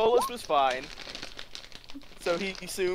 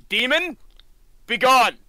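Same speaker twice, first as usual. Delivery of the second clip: loud, higher commanding cry; yelled banishment; 7 semitones higher, 6 dB louder, slower than usual. Acted. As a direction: yell it at a demon to drive it off. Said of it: "like, yells at her".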